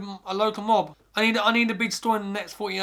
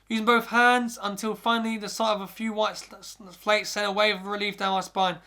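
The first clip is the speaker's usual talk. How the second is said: ordinary delivery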